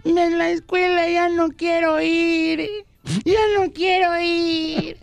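put-on high, slow, sobbing little boy; whiny child. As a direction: wail it like a crying kid. Sohn jammert beim Vater.

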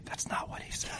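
hushed whispered aside. Retold or imitated. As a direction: lean in and whisper it slyly. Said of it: "Dmitry would just kinda nudge me and say".

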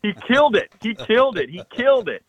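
high-pitched mock yell of triumph; retold excited screaming. Hunter celebrating his friend's kill, retold excited screaming. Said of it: "I am screaming as loud as I can".

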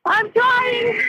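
real sobbing strain; crying out in desperation. Real, not acted. Trapped in sinking car.

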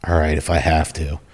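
sigh, then soft, low-energy reluctance; grudging compliance. Retold or imitated. Lazy officer answering a request to drop yard, grudging. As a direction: give in with a weary sigh, imitating a slacker.

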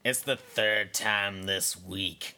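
put-on low, sour old woman; old hag voice. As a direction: sneer like a bitter old lady. Gladys nagging.

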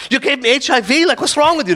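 high-pitched mock alarm; shocked outrage. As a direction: act out a horrified accusation. Retold shock at misheard HIV remark.

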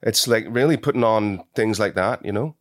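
Belfast accent, chatty; Northern Irish accent. Shown as a demonstration. Actor showing host on request, Belfast accent. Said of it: "Can you do an Irish accent".

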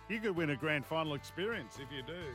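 ordinary talk, nothing marked

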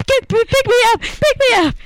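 high, loud, wailing cry; screaming crying fan. Retold or imitated. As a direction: shriek it through sobs. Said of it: "She's like".

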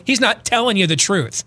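ordinary talk, nothing marked

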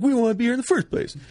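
fast, high, shouted taunt; leaving the bar, shouting back at them; mock shout. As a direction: yell it, rushing the words. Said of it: "I'm ad-libbing that".

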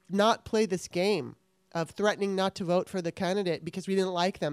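ordinary delivery

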